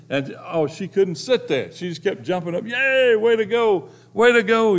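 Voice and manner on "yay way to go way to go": high, loud mock cheer, slow; excited cheering. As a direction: cheer like a fan on the sideline. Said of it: "She was all excited".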